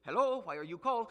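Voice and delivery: mock Indian call-centre accent. Pastor mimics phone operator in a mock Indian accent. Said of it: "like she's got this Indian accent".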